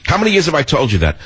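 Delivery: ordinary delivery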